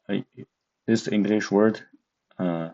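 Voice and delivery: normal talking voice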